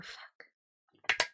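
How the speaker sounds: unvoiced whisper of frustration; whispered curse. Real, not acted. A streamer whispering a curse when the trailer plays too early. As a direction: breathe the curse out under your breath.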